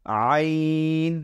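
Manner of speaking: "আইন" drawn out slowly from inside the throat; from deep in the throat. Shown as a demonstration. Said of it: "ভেতর থেকে উচ্চারণ করব".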